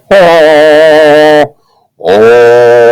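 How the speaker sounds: long, loud sustained "oh" tone; loud held "oh". Shown as a demonstration. Teacher demonstrating a loud sound wave, loud held "oh". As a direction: belt out a steady "oh". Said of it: "I'm gonna go low and loud".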